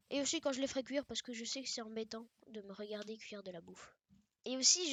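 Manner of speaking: ordinary talk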